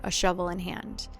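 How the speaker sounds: ordinary delivery, nothing marked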